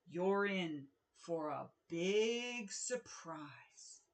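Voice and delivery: slow, low ogre voice. Acted. Ogre's note to the kingdom read in a slow ominous ogre voice. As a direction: drag it out like a looming ogre.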